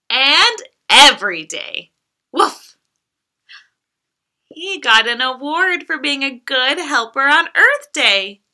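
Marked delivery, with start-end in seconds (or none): 0.1-2.8 lively, sing-song picture-book reading; reading to children. Acted. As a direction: read it like bedtime storytime for a toddler. Reads picture book aloud to child.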